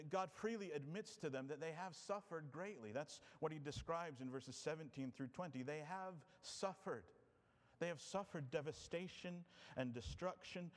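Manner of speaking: ordinary talk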